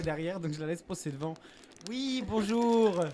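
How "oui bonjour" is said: loud, high, very drawn-out greeting; big slow put-on hello; announcing oneself. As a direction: stretch out a comic hello, pitched up. Plays the person stepping forward.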